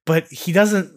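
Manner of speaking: normal talking voice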